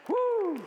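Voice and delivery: high shouted woo at the peak of the sermon; a preacher celebrating his own sermon with a loud, high whoop; excited whoop. Real, not acted. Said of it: "That's good preaching".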